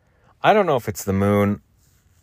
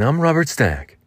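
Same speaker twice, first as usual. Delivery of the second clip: higher sing-song delivery; Robert Stack; 5 semitones higher than usual. Shown as a demonstration. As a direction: sing out the name, pitched up. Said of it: "you have to sing things".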